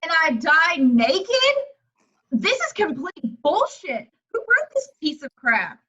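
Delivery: slow, loud outraged disbelief. Acted. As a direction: drag out the outrage. Outraged at her script death.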